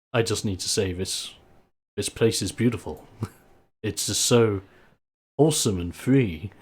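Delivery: soft, hushed wonder; quiet amazement. Acted. Wrestler admiring the farmstead to his hosts, softly awed. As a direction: take in the view softly. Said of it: "Erg is just standing there in amazement".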